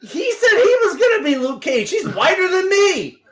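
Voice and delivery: high laughing outburst; laughing shout. Real, not acted. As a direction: shout it while cracking up. Laughing accusation at friend.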